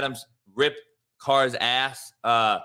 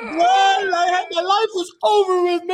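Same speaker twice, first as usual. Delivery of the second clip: fake-bawl it like a spoiled kid; mock crying. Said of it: "I'm crying".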